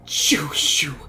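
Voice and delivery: whispered, urgent shooing; hissed shoo. Acted. Old woman shooing off a crow, whispered shoo. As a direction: hiss them away.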